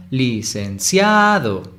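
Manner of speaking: "Licenciado" slowly, with CIA held long and punched; sílaba tónica marcada. Shown as a demonstration. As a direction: stretch and push the CIA in "Licenciado". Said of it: "¿Cuál de esas es la sílaba tónica?". ("Licenciado" lento, cargando la voz en CIA)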